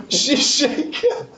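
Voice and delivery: high, slow laughing repeat. Real, not acted. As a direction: laugh out the words. Mocking repeat of DM's line.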